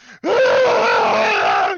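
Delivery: loud, high mock scream of a child; imitated child scream. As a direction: let out a kid's wail. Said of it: "She's fucking screaming again".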